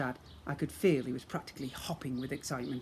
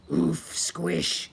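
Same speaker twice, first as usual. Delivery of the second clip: grunting troll voice; 2 semitones higher, 6 dB louder than usual. Acted. Troll Oof speaks.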